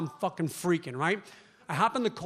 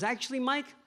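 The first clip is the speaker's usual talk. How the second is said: a hint of a different, higher doctor voice; deadpan doctor; 5 semitones higher than usual. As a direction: drop the punchline as the calm doctor. Doctor delivers punchline.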